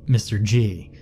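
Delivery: usual speaking voice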